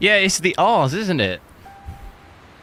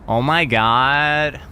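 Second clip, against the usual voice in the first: put-on slow drawn-out Valley Girl Oh my God; Valley Girl accent; much slower than usual. Shown as a demonstration. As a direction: stretch it like a Californian Valley Girl. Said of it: "the Valley Girl".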